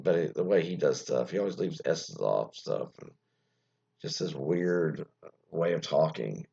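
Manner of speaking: normal talking voice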